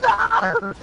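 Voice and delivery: very high yell of protest; dismayed scream. Real, not acted. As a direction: shriek it in protest. Dismay during the game.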